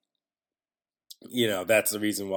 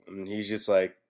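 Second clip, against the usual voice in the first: usual speaking voice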